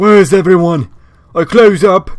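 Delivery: lightly hammed-up British rocker accent. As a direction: wander the bathroom as a hardcore British rocker. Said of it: "British rocker with, like, a weird, like, raspy voice".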